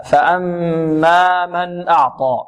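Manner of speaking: فأما with the doubled meem held nasally; ghunna on فأما. Shown as a demonstration. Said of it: "فأما هنا غنة". (غنة واضحة على الميم في فأما)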